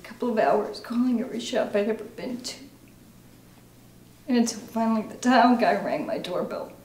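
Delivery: halting confession, growing distraught; anguished recovering alcoholic. Acted. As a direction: let the guilt build through heavy pauses. Acting exercise, painful confession about daughter.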